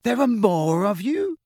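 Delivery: high, amazed question; stunned disbelief, rising high. A narrator's reading. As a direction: pitch right up in astonishment.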